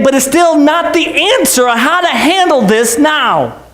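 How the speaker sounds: high, ringing pulpit voice, hammering each line; preaching at full force. Acted. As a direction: preach it like the roof should hear.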